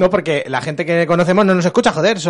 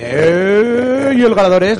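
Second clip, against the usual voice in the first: booming prize announcer; 6 semitones higher, 5 dB louder, slower than usual. Acted. Announcing the giveaway winner.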